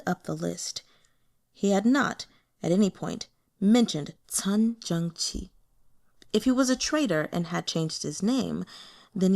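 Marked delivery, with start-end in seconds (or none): none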